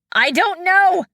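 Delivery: high, loud wail. Acted. Fen wails to Shang from the trap. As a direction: wail it in panic. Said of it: "she wailed".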